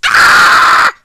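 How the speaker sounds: very loud scream of frustration; angry yell. Real, not acted. Gamer venting anger at the game, loud scream. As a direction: let the anger out in one sudden yell. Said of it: "I always yell".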